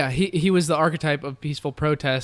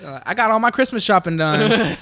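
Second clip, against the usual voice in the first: mock Southern accent; 5 semitones higher, 4 dB louder than usual. Mocking a looter bragging about shopping, Southern accent.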